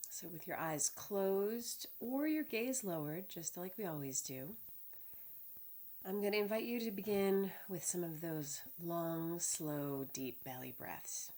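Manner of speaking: unhurried body-scan guidance with long pauses; measured, spacious meditation voice; guided meditation. Real, not acted. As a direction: lead them calmly through the body, phrase by phrase.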